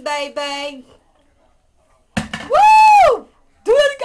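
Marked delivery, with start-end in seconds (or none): 2.2-4.0 very high, loud whoop; excited shout. Real, not acted. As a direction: whoop it up for the bird. Cheering the bird's score.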